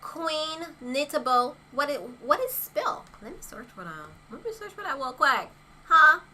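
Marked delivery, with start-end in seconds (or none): none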